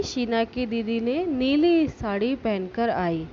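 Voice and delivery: ordinary delivery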